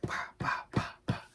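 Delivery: whispered pats; patting sounds. Retold or imitated. Dad imitating nurses patting the baby, whispered. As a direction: tap it out under your breath. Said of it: "going like this to him".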